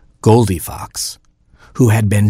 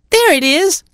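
very high squeaky little chick; a tiny baby chick whining. Acted. Baby chick complaining to parents, very high squeak.

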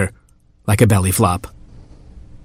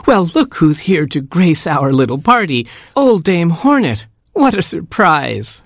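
high, sugary fake welcome; false brightness; 8 semitones higher than usual. A narrator's reading. Queen frog fakes a welcome to the hornet, sugary and high. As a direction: greet the enemy with a forced sweetness. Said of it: "She put on her brightest face".

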